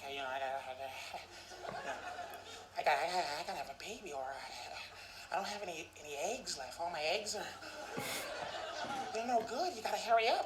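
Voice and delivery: soft, rushed mock panic; panicking girlfriend. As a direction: fret like she's out of time. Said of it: "she's like".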